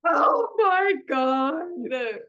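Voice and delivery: very slow, loud, drawn-out gasp of delight; overwhelmed. Real, not acted. Unwrapping a beloved gift from a friend, awed and loud. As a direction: let it stretch out in awe.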